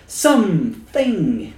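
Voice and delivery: high, drawn-out mock dread; hammed-up TV panic, slowing dramatically; Twilight Zone impression. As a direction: quote it high, stretching the last word right out. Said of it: "That's the most famous episode of Twilight Zone".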